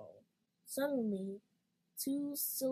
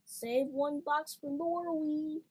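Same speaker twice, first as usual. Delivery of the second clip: shouting; 6 semitones higher, 3 dB louder than usual. A narrator's reading. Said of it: "The red crutch shouted".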